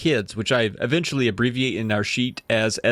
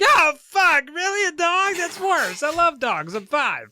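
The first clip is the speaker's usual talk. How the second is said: put-on much higher squeaky five-year-old; upset little kid. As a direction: whine it like a heartbroken toddler. Five-year-old Nat upset about dog.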